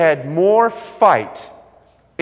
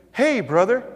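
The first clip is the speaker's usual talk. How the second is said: higher, bright mock-friendly hail; friendly greeting; 7 semitones higher than usual. Carpet guy greets the preacher, higher mock-friendly hello. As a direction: greet him like an old pal. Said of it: "That's what he said to me".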